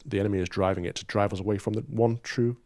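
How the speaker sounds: usual speaking voice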